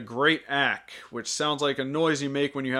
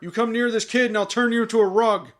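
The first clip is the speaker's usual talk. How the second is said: higher, louder, stern warning; protective forest lord; 7 semitones higher, 4 dB louder than usual. Pretend. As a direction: warn the animals like a protective overlord. Reviewer voices Ak threatening animals.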